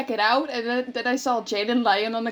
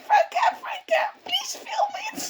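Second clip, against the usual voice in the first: imitating crying plea. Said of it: "she was, like, crying".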